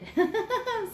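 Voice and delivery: laugh. Real, not acted. Laughs after computer dies.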